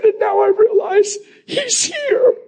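very high, slow tearful squeal; imitated sobbing. Head of HR realizing he's here, sobbing. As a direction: squeak it out through tears. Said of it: "she starts bawling".

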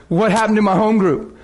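ordinary talk

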